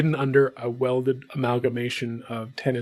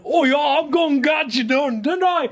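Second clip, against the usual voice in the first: put-on much higher, mumbled Yorkshire accent; thick Yorkshire accent; 13 semitones higher than usual. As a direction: make the Yorkshire almost impossible to understand. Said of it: "incomprehensible, like, Yorkshire accent".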